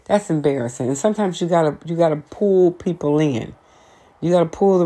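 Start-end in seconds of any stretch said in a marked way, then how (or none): none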